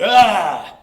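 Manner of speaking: sudden loud high "Ah!" to scare; a kid leaping from a closet with a loud scare shout; startling yell. Retold or imitated. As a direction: jump out and yell "Ah!". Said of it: "he jumped out of the closet and went".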